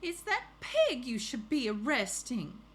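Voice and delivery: put-on storybook neighbor with a Southern accent. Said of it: "with my southern accent, so let's do it again".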